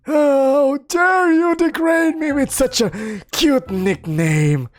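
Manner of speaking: high, slow, wailing complaint; hammed-up tragic wail; melodramatic wail. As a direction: wail like a wounded diva. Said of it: "let out a melodramatic wail".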